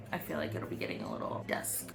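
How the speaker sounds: whispered aside; a quiet whispered thought about dusk. Real, not acted. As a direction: say it under your breath. Unvoiced remark.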